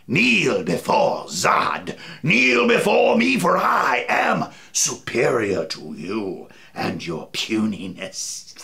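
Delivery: deep, slow, menacing villain decree; Zod voice. Pretend. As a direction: growl it like a cold rasping evil emperor. Repeats the Zod villain line.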